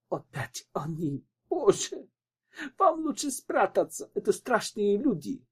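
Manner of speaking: high-pitched, panicky warning; acted alarm, voice leaping up in fear; frightened. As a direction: urge them to hide, terrified. Widow hears thugs on the stairs.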